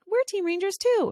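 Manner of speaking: put-on high, eager small-girl voice; lightly voiced little girl chiming in; a hint of a young daughter joining the team; little daughter's voice. Daughter answering other kids.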